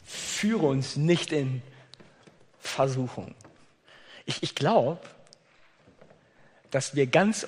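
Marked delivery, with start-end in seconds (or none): 0.0-3.4 prayerful. Acted. Quotes the Lord's Prayer line.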